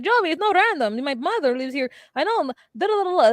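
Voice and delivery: mock wife's reply. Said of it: "And she's like".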